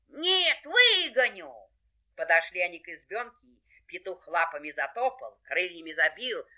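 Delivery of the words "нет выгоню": high, swelling vow; determined rooster. Acted. Rooster contradicts the hare, determined. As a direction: insist in a high, strong voice. Rooster insists he will manage.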